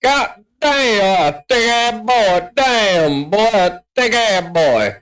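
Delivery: high-pitched mock wail. Hamming up admiration of big guy.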